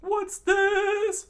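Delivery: very high, loud question; high-pitched put-on figure voice; Sanji character voice. A narrator's reading. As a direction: squeal the question loudly. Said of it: "Sanji said".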